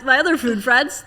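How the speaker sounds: normal talking voice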